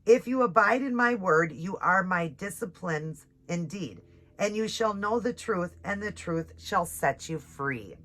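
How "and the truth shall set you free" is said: ordinary talk, nothing marked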